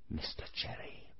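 whispered nickname. A narrator's reading. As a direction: breathe it secretly.

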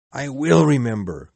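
a loud, fervent cry of promise. Acted. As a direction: call it out after the fading ghost. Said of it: "cried Scrooge".

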